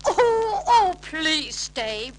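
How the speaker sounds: put-on high, loud, slow pleading old man; begging old uncle. A narrator's reading. Uncle Albert begging his guests to stay, pleading. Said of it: "begged Uncle Albert".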